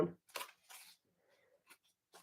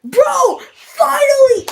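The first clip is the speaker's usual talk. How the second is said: very high, loud outburst; exultant shout; 9 dB louder, much slower than usual. Real, not acted. Streamer finally vindicated about a name, shouting. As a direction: yell it out high, drawing out the words.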